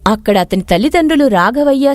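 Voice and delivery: ordinary delivery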